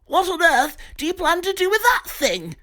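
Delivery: high frightened chap; alarmed Pig. Acted. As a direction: demand it in high panic.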